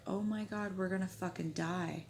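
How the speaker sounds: soft, resigned retold fear; hushed dread. Retold fear of dying at gunpoint.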